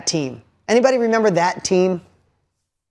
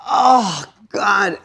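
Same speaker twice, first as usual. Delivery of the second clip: pained groan; 2 semitones higher than usual. Retold or imitated. Father's pained reaction to crying daughter.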